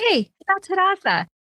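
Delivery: ordinary talk, nothing marked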